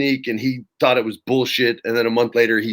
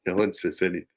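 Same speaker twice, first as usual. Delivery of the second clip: normal talking voice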